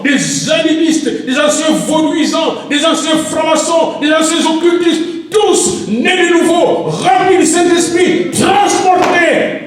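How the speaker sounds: preaching at full force. Acted. Pastor proclaiming to the congregation that Jesus lives, full-force preaching. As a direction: shout the good news to the church. Peak of the sermon.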